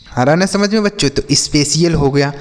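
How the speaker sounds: usual speaking voice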